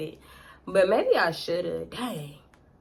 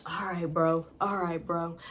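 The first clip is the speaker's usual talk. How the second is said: low, quiet, resigned male voice; imitating Rodney; 4 semitones lower, 7 dB quieter than usual. Man giving up on persuading her, low quiet acceptance. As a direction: drop low and soft, giving in. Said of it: "He was like".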